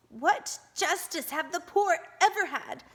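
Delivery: high, loud bitter outcry; bitter cry. A narrator's reading. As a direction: cry it out against the rich.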